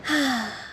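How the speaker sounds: soft sigh. Acted. Nostalgic return to the port.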